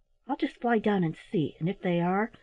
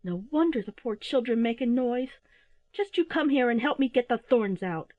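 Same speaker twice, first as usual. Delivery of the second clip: indignant Mother Owl; 6 semitones higher than usual. A narrator's reading. Mother Owl berating her husband, indignant scolding. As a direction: scold in a high voice, then order. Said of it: "cried old Mother Owl".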